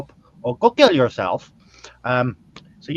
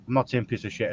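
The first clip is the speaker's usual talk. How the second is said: usual speaking voice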